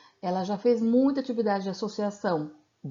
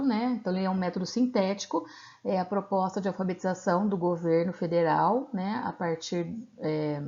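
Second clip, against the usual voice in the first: ordinary talk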